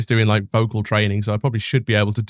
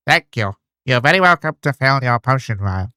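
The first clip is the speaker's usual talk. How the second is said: mock copy of the potion seller's line, a bit louder; imitating the voice actor; 4 dB louder than usual. Streamer mocking the game's voice actor, a bit louder. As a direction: parrot the shopkeeper's stilted read. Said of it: "the person who did that voice acting did this".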